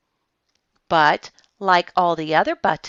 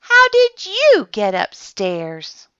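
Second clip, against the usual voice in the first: higher, louder, slow puzzled question; watchman asking kindly; 7 semitones higher, 5 dB louder than usual. A narrator's reading. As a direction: ask the little bear slowly, amused. Watchman talks to the bear.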